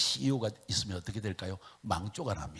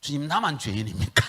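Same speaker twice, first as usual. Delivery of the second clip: louder, higher mock protest; indignant question; 4 semitones higher, 10 dB louder than usual. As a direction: ask as if wronged. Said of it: "또 억울한 거예요".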